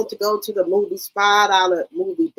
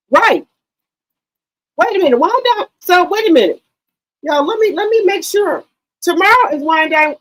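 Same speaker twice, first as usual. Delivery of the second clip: sudden realization; 7 semitones higher, 4 dB louder than usual. Real, not acted. Suddenly realizes which day it is.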